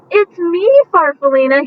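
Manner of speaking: high, loud storybook shout of recognition. Acted. Marcel recognising his lost friend, shouting gladly. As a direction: call it out with joy. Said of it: "he shouted".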